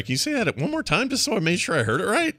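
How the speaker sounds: mock-polite request. Shown as a demonstration. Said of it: "Like that".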